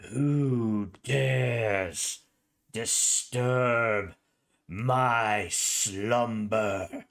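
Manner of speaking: slow, booming voice from the grave; ancient rasping undead king. Acted. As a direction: draw out each word like a waking corpse king. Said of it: "and you hear".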